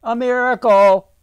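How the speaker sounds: loud, high cry of wonder; calling out. A narrator's reading. Amazed islander calls across the square, loud and high. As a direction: call it out high to the whole square. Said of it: "someone called".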